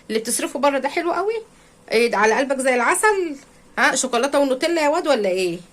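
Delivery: high, fast sarcastic jabs; sarcastic mocking. Real, not acted. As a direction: needle him with sarcasm, quickly. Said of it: "speaker mocks husband's spending outside". (سخرية سريعة وبصوت عالي)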